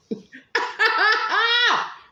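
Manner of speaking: loud high cackle at her own joke; big cackling laugh. Real, not acted. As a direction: throw back a loud delighted cackle.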